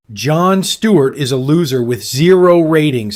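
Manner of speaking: loud, sharp angry bark. A narrator's reading. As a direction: bark it across the desk. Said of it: "he barked".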